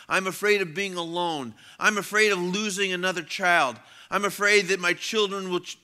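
loud, high-pitched litany of fears; impassioned preaching. A narrator's reading. Preacher reads someone's confessed fears to the congregation, impassioned. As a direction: read each fear out forcefully.

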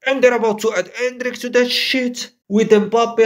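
ordinary talk

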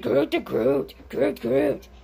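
mock Groot voice. Shown as a demonstration. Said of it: "Everyone can do that".

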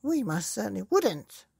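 put-on high, loud old woman's voice; Mrs Twit crying out. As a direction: insist eagerly. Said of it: "cried Missus Twit".

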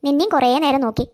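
put-on a fond elderly neighbour, coaxing; kindly old neighbour woman. Neighbour invites child to eat. (സ്നേഹമുള്ള പ്രായമായ അയൽക്കാരി, താഴ്ന്ന ശബ്ദത്തിൽ)

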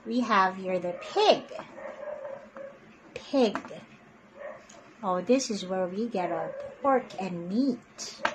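ordinary delivery

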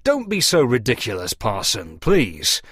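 plain reading voice

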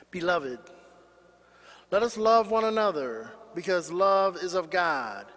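usual speaking voice

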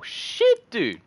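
very high startled curse; startled yelp. Real, not acted. Gamer caught off guard by the enemy, startled yelp. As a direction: burst out high in sudden shock. Startled mid-sentence by the game.

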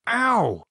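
high, loud ow of pain; pained yelp. Acted. David pinched on the arm, yelping.

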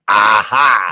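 put-on loud Russian-accented exclamation. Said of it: "you had to use the Russian accent".

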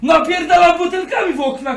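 high, loud shout; angry yelling. Real, not acted. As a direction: yell it out in a real quarrel. Yelling in a real window quarrel.

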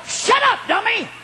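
high, shouted rebuke to himself; harder self-scolding. Retold or imitated. Preacher retelling how he kicked himself, shouted scolding. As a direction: yell it at yourself in exasperation. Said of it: "I'm kicking myself harder".

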